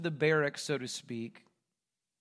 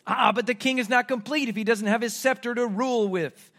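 mock-grand and higher; 5 semitones higher, 6 dB louder than usual. Soldiers completing the mock king's costume, mockingly. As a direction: play up the fake ceremony. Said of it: "mocking type of way".

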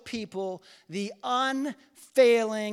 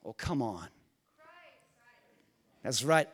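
ordinary talk, nothing marked

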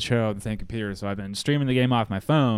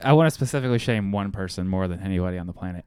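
ordinary talk

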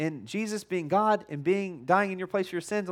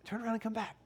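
put-on higher, softer no no no to a child; gentle higher calling; 7 semitones higher, 9 dB quieter, faster than usual. Church calling a wandering member to turn around, higher and softer. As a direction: coax the kid back, high and soft. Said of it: "we're going".

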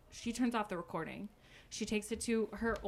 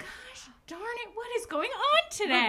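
hammed-up fed-up complaint; mock exasperation; 6 dB louder than usual. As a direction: wail it in comic frustration. Acted frustration at another interruption.

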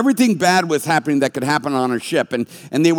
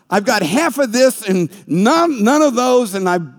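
ordinary delivery

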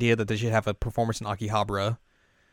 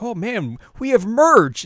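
high, louder mock voice of gleeful staff; mocking school staff; 11 semitones higher, 8 dB louder, slower than usual. Mocking the school's merch boasting in a high, loud voice. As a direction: lampoon them as smug and pleased.

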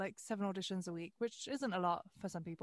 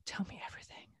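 breathless whispered plea; eager whisper; 7 dB quieter, faster than usual. Retold or imitated. Newcomer begging a voice actor for secrets, whispered. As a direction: whisper it like a hungry fan.

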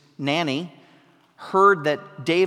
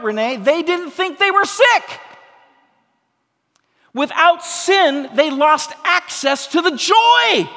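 loud, higher, ringing pulpit voice, slowed on the key lines; preaching at full force; 7 semitones higher, 7 dB louder than usual. Acted. Preacher to congregation on sin and joy, preaching at full force. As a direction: hammer home that the righteous miss the joy.

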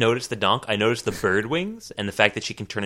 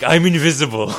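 mock heroic boast; 5 semitones higher, 7 dB louder than usual. Acting out hero ripping off shirt.

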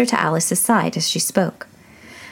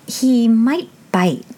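cautious; 6 semitones higher, 4 dB louder, much slower than usual. A narrator's reading. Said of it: "Alice cautiously replied".

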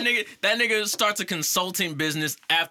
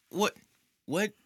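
usual speaking voice

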